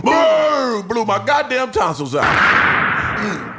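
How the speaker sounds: loud, high shout of the gunshot; acted-out blast. Acting out the shotgun blast through the peephole, loud high shout. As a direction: belt out the boom like a shotgun going off.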